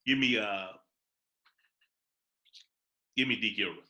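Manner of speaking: subdued deadpan, pitched low; straight-faced. Real, not acted. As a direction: deliver the pick straight-faced, with pauses. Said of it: "with a straight face and everything".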